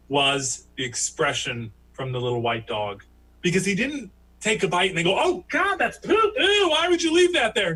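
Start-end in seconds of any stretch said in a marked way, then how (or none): 5.2-7.8 high, loud horrified outburst; hammed-up revulsion, loud and high; mock disgust. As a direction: act out a grossed-out dog, pitched way up. Imagined disgusted reaction of the dog.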